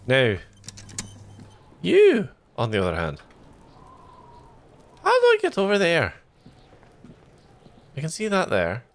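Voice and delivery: tired, inconsistent mock Scottish accent; bad Scottish accent. Shown as a demonstration. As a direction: attempt Scottish and let it slip in and out. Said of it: "five minutes of a bad Scottish accent".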